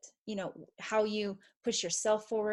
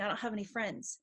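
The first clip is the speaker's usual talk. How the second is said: softer, dejected self-talk; quiet mopey voice; 8 dB quieter, faster than usual. Retold or imitated. Mother voicing a sad inner voice, softer and dejected. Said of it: "the voice that's saying".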